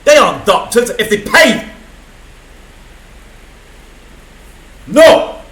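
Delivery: loud, angry outburst; pissed. Real, not acted. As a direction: hurl it out in real fury. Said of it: "God, I was pissed".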